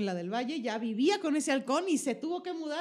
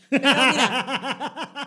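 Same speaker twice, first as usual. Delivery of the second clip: laughing; 8 dB louder, much slower than usual. Real, not acted. Laughing mid-explanation.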